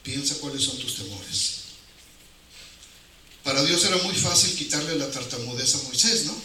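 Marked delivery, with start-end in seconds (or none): none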